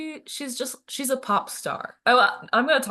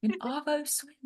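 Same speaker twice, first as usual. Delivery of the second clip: higher, softer Australian imitation; Australian accent; 6 semitones higher, 6 dB quieter than usual. Repeating a YouTuber's catchphrase, Australian accent. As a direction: echo the phrase in an Australian accent. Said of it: "So Australian".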